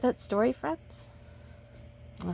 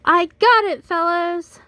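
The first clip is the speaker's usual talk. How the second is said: high, loud call across the field; cheerful loud call, pitched up; 9 semitones higher, 7 dB louder than usual. Acted. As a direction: call out proudly to the team. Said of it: "she called".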